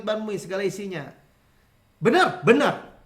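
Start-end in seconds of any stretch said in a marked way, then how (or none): none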